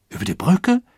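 loud, high rising echo; echoing question. A narrator's reading. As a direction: repeat it as an astonished question. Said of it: "echote ein Passagier". (erstaunt fragend wiederholen)

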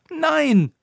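a very high, loud scream of no. Acted. Roppucher screams at Koníček, very high loud scream. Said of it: "schrie sie".

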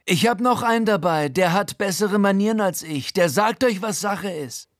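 high, strained wreck of a voice, cocky threat; a battered crook barking orders; Frankies fertige Stimme. Acted. Frankie boasts during the raid. (hohe, kaputte Gaunerstimme, frech drohend)

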